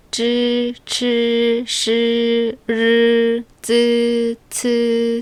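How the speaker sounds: 쯔 치 시 르 with tongue curled up, then 쯔 츠; retroflex initials. Shown as a demonstration. Teacher modeling retroflex sounds for learners. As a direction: curl the tongue back for each. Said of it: "으로 발음한다는".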